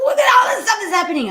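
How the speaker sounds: high, fast, shouted complaint; overwhelmed outburst. Retold or imitated. Overwhelmed patient shouts at her doctor about her chaos. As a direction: blurt it out in a rush.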